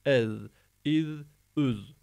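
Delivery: "el, iz, üz" with voiced th endings; tongue between front teeth, buzz each ending; lisped Z. Shown as a demonstration. Teacher demonstrating tongue placement, lisped "el, iz, üz". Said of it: "dilimizi ön dişlerimizin arasına alıp".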